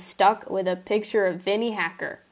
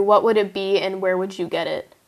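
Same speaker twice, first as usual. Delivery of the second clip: ordinary delivery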